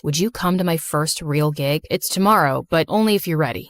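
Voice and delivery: low-voiced, considerate boyfriend; boyfriend Walker. Boyfriend invites her to his first gig, low careful invitation.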